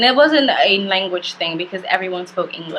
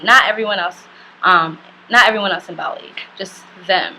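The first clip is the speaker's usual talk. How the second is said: ordinary talk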